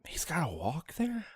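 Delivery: breathy whispered disbelief, no voice; surprised whisper. Real, not acted. Hearing his companion must walk, whispering in surprise. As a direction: whisper it in mock shock.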